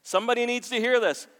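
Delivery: loud, high preacher's shout; impassioned shouted proclamation; passionate preacher. Shown as a demonstration. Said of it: "a very weak version of passionate".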